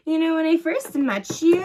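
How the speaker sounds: high-pitched mock acquaintance voice; mocking how people talk. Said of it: "make fun of how people talk".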